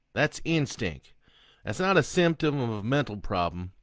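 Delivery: loud, high, sneering convict; defiant killer taunting. Acted. Killer Sloan taunting the priest, loud and sneering.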